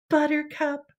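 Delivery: breathy whisper of the pony's name; hushed reveal of Buttercup. Acted. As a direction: whisper it like a precious secret. Dreamy reveal of pony name.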